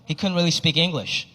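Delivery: ordinary talk, nothing marked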